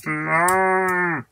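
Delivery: playful cow moo; cow impression. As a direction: moo like a cow, half-joking. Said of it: "the best cow impression I've ever done".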